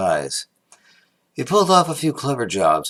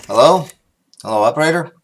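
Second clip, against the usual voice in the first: calling into phone; 4 semitones higher, 7 dB louder, faster than usual. Acted.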